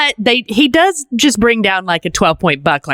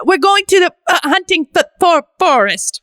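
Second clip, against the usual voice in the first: fumbling Hugh Grant; 10 semitones higher than usual. Retold or imitated.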